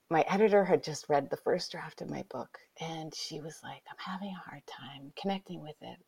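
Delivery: hushed retelling. Retold or imitated. Retelling a painful moment, hushed.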